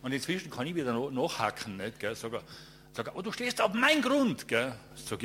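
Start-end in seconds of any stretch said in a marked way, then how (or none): 3.1-4.5 high, loud territorial shout; angry yell. Retold or imitated. Owner yelling at the photographer about his land, loud and high.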